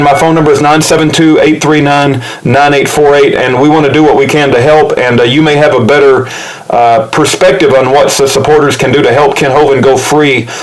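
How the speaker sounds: ordinary talk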